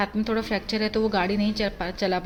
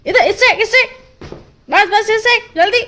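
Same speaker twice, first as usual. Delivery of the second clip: urging the dog; 13 semitones higher, 10 dB louder than usual. Real, not acted.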